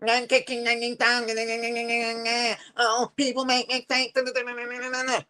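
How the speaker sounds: sing-song mocking chant of a dismissive crowd; mild mock sing-song of careless people. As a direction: toss off the nah nahs like a taunting singalong. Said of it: "People saying".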